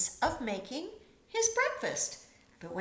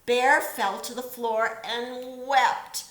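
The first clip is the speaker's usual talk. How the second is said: plain reading voice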